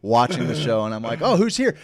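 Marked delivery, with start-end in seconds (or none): 1.2-1.8 high, jumpy query; startled question. Retold or imitated. Sped-up worker told of a visitor, startled. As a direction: pitch it up, caught off guard.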